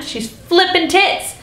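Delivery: high, drawn-out mock hysteria; playfully over-dramatic. Real, not acted. Describing her monologue character losing it, over-dramatic. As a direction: stretch it out high, hamming up the meltdown.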